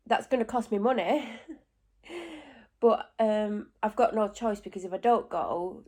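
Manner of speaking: normal talking voice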